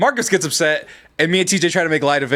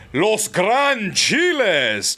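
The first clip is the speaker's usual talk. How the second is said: drawn out; 4 semitones higher, much slower than usual. Acted. Calling out patron group name.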